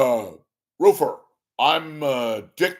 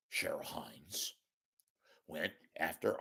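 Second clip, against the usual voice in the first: conspiratorial whisper; 5 semitones lower, 16 dB quieter than usual. Real, not acted. Sharing a gossipy hunch.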